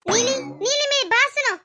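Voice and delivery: sister-in-law angrily summoning Neeli; angry call. Acted. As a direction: call her in, annoyed and demanding. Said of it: "आप इतने गुस्से में क्यों हैं?".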